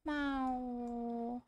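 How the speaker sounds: quiet little meow; soft cat meow. Pretend. Meowing at cat in game.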